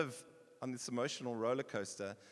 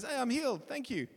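casual thanks; 8 semitones higher, 6 dB louder than usual. Retold or imitated. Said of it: "from a distance says".

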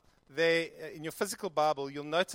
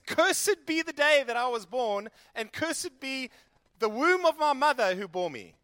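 anguished curse; 6 semitones higher, 6 dB louder than usual. Acted. Jeremiah cursing his birth day, loud and anguished. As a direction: curse the day you were born.

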